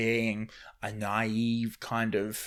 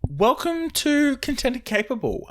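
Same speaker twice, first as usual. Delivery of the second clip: bright, high announcer welcome; podcast intro voice; 13 semitones higher, 6 dB louder than usual. Acted. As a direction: open the show like a host.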